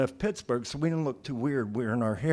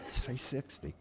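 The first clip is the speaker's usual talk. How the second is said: soft aside, feeding an answer; quiet prompting; 9 dB quieter than usual. Acted. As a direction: suggest it discreetly to a friend. Low suggestion to driver.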